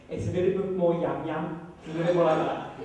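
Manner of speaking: a quick Birmingham yam-yam twang; light Birmingham accent, laughing. Shown as a demonstration. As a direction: drop into a Brummie voice for a moment. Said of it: "the Birmingham accent is very different".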